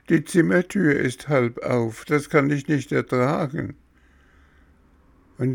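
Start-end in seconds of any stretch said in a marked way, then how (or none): 0.1-3.7 put-on high-pitched, protesting child figure; high, fussy boy stalling over medicine; whiny puppet boy. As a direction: voice a stubborn little patient, high and insistent. Voices fussing Bengele.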